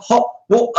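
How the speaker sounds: "hot water" with the T skipped, as "wo'-uh"; mocked British pronunciation. Imitating friend who mocks them, exaggerated British "hot water". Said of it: "a friend in L A who always mocks us".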